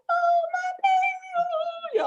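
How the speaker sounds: high, very slow tender coo. Retold or imitated. Mother first seeing her newborn daughter, high slow tender coo. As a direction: melt over the newborn, drawn out and high.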